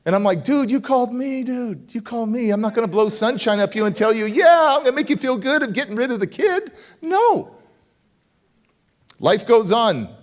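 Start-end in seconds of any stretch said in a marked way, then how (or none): 0.4-7.5 high-pitched, incredulous pushback; retold exasperation, ending on a high, firm no; exasperated retort. Speaker refuses to sugarcoat for the man who called, exasperated.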